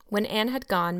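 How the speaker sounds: plain reading voice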